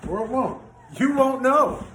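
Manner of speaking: high teasing voice; playful high tease. Real, not acted. Teasing wife's comment.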